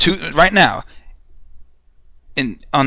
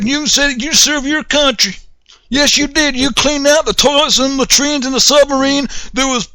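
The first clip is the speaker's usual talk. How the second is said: sarcastic patronizing pat-on-the-head voice, much higher; mocking praise; 11 semitones higher than usual. Recruiter flattering a young soldier, mock high sarcastic voice. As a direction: gush fake praise at a gullible recruit.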